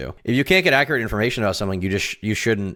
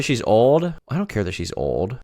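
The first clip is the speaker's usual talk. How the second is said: ordinary talk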